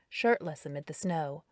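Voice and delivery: plain reading voice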